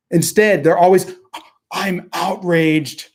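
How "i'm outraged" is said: slow, drawn-out mock indignation; mocking fake outrage. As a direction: parody a pearl-clutching pundit. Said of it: "pearl clutching".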